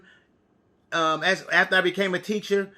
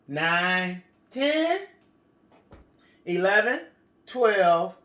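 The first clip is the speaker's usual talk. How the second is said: put-on reading to a toddler; much slower than usual. Counting aloud for children.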